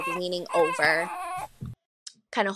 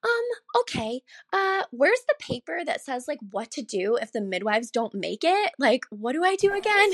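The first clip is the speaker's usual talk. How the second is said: flustered helper; 6 semitones higher than usual. Retold or imitated. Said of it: "And she's like".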